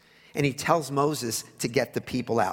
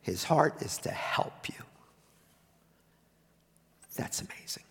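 whispered, much softer; hushed awe, whispered; 7 dB quieter than usual. Real, not acted. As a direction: lean in and breathe it to them. Tender point in sermon.

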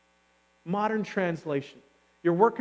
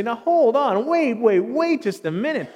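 loud, higher protest, wait wait; objecting; 5 semitones higher, 9 dB louder than usual. Retold or imitated. A doubter loudly protests climbing the mountain. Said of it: "somebody like me who's gonna say".

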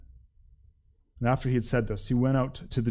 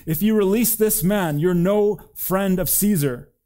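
high, loud crowd protest; raised, insistent narrated shout; 7 semitones higher, 6 dB louder than usual. A narrator's reading. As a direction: shout a warning at the governor.

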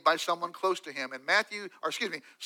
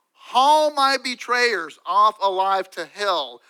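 slow and louder, each word weighed; slow dramatic curse; 7 dB louder, much slower than usual. Acted. David calls down judgment, slow and forceful. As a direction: pronounce the curse with heavy deliberate force.

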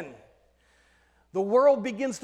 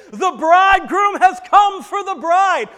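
joyful shout; 9 semitones higher, 14 dB louder than usual. Acted. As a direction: proclaim it loud and high, like a herald's cry. Shout announcing bridegroom's arrival.

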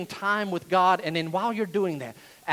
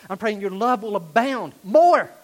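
"more" punched loud, then falling; emphatic MORE; 8 dB louder than usual. Acted. Paul correcting the Philippians on his prayer, emphatic. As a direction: hit "more" hard at the end.

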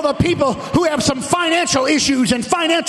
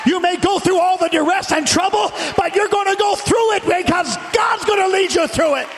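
full-force preaching; 4 semitones higher than usual. Real, not acted. Preacher urging the congregation to praise through trials, full-force preaching.

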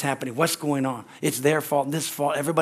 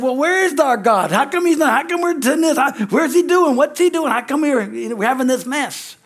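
high, louder, fretful questions; whining Gideon; 9 semitones higher, 5 dB louder than usual. Retold or imitated. Gideon complains to the angel, higher and fretful. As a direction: complain to God like a doubting Gideon. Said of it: "Sounds like Gideon".

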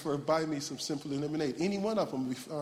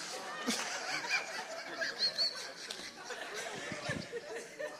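long, high wheezing laugh; helpless laughter; 8 dB quieter than usual. Real, not acted. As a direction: crack up, nearly soundless. Cracks up at own story.

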